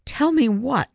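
high, alarmed question. Acted. Boy questioning his father about news he hasn't heard, alarmed. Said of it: "Griffin was alarmed".

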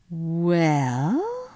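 long drawn-out, rising hesitation; rather slowly. A narrator's reading. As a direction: stretch the word while searching for kind honesty. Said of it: "said Lucy rather slowly".

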